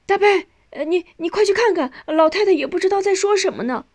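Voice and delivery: high, hurried call for help. A narrator's reading. Nanny runs out to fetch the family, calling urgently. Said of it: "保姆跑出来喊人".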